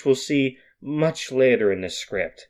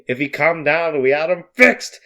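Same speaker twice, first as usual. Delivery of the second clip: ordinary delivery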